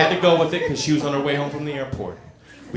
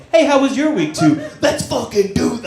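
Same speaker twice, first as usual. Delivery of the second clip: mock eager; 5 semitones higher than usual. Imagined jump to sex.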